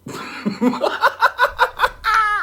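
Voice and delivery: high, breathy burst of laughter; cackling laugh. Real, not acted. Host laughing at the mock dialogue, cackling.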